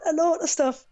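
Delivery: put-on a light go at a British accent; a hint of British English on lot of stuff; mock British accent. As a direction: copy the Brit's accent, half in jest. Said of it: "You don't have to copy my accent".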